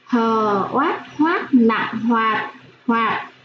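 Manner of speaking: teacher spelling of hoạt; spelling then reading. Shown as a demonstration. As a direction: sound out Hờ, oát, add nặng, and land on hoạt. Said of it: "Cô đánh vần và đọc trơn".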